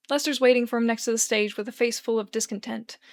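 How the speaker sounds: ordinary delivery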